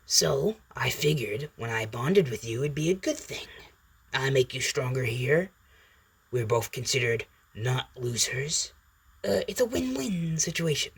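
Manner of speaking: lightly voiced symbiote, making its case; Venom's persuasive pitch. Symbiote pitches the bond to the boy, persuasive.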